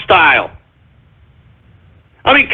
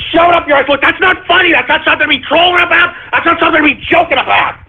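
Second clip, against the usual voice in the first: angry scolding; 6 semitones higher, faster than usual. Acted. Continues angry rant.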